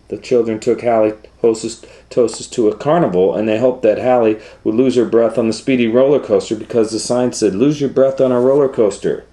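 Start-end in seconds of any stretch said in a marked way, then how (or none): 3.3-9.2 fast, louder and higher; excited narration. Acted. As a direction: rush it with thrill.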